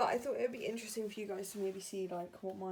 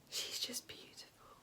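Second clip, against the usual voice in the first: much softer unvoiced whisper; 11 dB quieter than usual. Real, not acted. Mother whispering about her beautiful baby, softly. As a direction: breathe it out tenderly.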